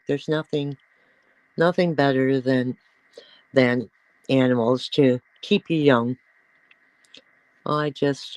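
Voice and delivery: ordinary delivery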